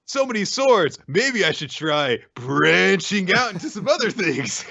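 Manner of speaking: high put-on voice, stretching the pun; milk a corny joke slowly; hammed-up punning. Playing Asta making forced tree puns.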